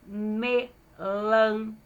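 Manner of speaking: "melancia" split into me, lan, ci, a; syllable by syllable. Shown as a demonstration. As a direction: dictate "melancia" slowly, each syllable held. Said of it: "Falei sílaba por sílaba".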